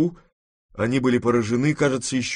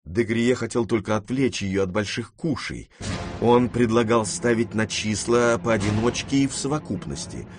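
usual speaking voice

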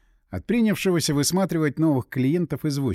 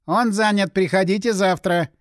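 mildly impatient secretary; 5 semitones higher, 4 dB louder than usual. Acted. Busy secretary turns a visitor away.

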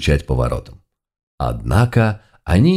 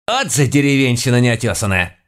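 snapped; 6 semitones higher than usual. A narrator's reading. Housekeeper cutting in on the governor, snapping. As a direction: scold him as an affronted old woman. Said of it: "рявкнула моя домохозяйка".

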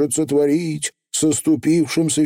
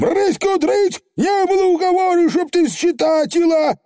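high furious pixie complaint; outraged Feegle. Acted. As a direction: cry foul at the witch. Feegle protests the counting.

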